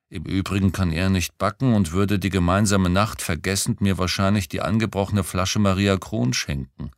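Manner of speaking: usual speaking voice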